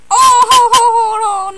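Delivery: crying shout. Acted. Mario shrinking after a hit, loud wail. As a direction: howl in dismay.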